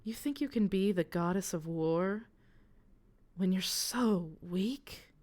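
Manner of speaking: lightly voiced, mocking goddess; taunting goddess. Interna goading Mania to fight the halfling, taunting. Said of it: "Interna laughs, and she tilts her head".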